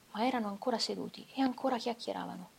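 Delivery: plain reading voice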